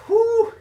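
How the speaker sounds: big high whooshing blow of breath; loud exhale. Real, not acted. Coach to viewer mid-workout, loud winded whew. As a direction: blow it out hard after the effort. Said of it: "I'm a little bit out of breath".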